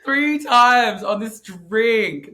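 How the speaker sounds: Three times drawn out with weight on times; slow it right down and lean on times; three times stretched slowly. Real, not acted. Telling a friend how often she slipped, slow and emphatic.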